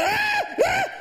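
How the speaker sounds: sudden high-pitched shriek of surprise; startled high yelp. Real, not acted. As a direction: jump and squeal at the bang. Reaction to the experiment bang.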